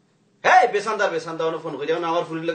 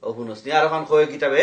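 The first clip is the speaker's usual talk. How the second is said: ordinary delivery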